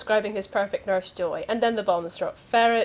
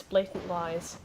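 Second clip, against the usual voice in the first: normal talking voice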